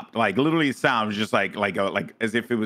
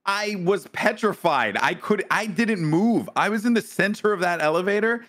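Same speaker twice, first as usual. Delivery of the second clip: emphatic self-defence; 6 semitones higher than usual. Real, not acted. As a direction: plead the case with exasperated emphasis. Defending himself to teasing friends.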